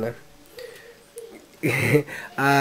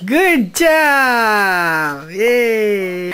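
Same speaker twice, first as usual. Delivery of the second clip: excited praise; 4 semitones higher, 3 dB louder, much slower than usual. Real, not acted. Praising the child.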